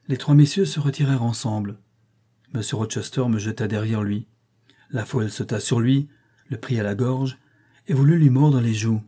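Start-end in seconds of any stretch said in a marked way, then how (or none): none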